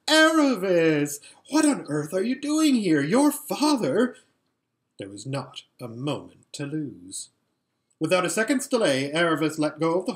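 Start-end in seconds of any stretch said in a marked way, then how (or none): 0.1-4.1 loud, high startled outburst; top of her voice. A narrator's reading. Lasaraleen spots Aravis from the litter, at the top of her voice. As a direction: burst out with it, stretching the name. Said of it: "burst out at the top of her voice".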